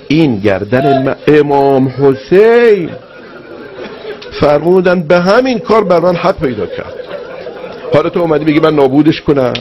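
high, swelling voice of a moved preacher; impassioned preaching. Retold or imitated. Preacher voicing Imam Hussein's gratitude to the enemy, impassioned cry. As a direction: draw out the holy name, then press on high and full.

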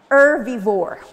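herbivore said loud and clear; teaching new word. Shown as a demonstration. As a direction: announce herbivore to the class. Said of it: "we can call it".

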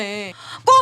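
a sudden, loud cry of goal; goal shout. Real, not acted. Streamer shouting as Real score. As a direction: yell it as the ball goes in.

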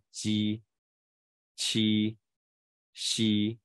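鸡, 七, 吸 one at a time, held and level; slow palatal consonant model; repeat-after-me drill. Shown as a demonstration. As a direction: leave room to repeat after 鸡, 七, 吸. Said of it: "在家跟着念一下".

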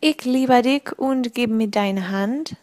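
slow, careful German recitation; slow schoolroom German; recited German. A narrator's reading. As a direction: recite the German sentence slowly, as if learned by rote. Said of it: "I recited it to Anastasia".

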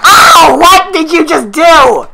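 high, loud gamer outburst; exasperated shout. Pretend. Player failing to kill opponents, exasperated shout. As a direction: cry out in frustration at the game. Frustrated killer in game.

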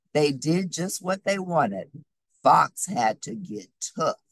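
ordinary delivery

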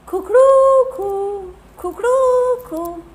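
high, loud, slow croaky crow; hoarse rooster crow. Acted. Teacher voices Tikku's croaky crow in story. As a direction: crow with a broken throat. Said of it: "इसकी आवाज़ कैसे बदल गई?".

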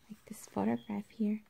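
quick soft whisper. Acted. Whispered aside to class.

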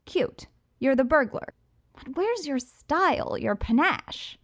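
high, loud mocking lilt; Riddler's teasing. A narrator's reading. Riddler, cocky and mocking. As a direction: Riddler needles the burglar about her style.

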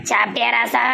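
put-on a cunning old mother coaxing her son; scheming mother.

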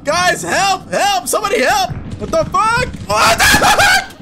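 high-pitched cries for help; panicked calling. Real, not acted. Player trapped in the game calls to teammates, panicked. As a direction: call out frantically, pitch way up.

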